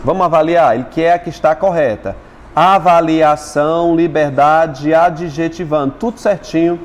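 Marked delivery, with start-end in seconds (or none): 2.5-5.9 slow, deliberate teacher reading; syllable by syllable. Shown as a demonstration. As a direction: draw out each word's syllables. Reading syllable-divided words to class.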